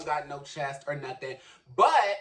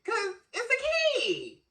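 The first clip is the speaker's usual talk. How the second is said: very high, slow, put-on squealing voice; keying voice; slower than usual. Shown as a demonstration. Showing the voice that comes out when drunk with friends. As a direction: shoot way up into the party voice. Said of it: "that is when this voice comes out".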